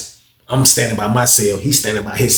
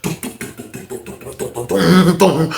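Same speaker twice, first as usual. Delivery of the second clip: imitated running footsteps; 4 semitones higher than usual. Inmate racing up the steps, imitated footfalls. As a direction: mouth the thuds of feet on stairs. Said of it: "went up the steps like Bad News".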